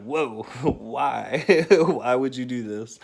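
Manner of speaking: laughing, grossed-out why; laughing disgust. Retold or imitated. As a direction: laugh through the complaint. Dad reacting to sneeze.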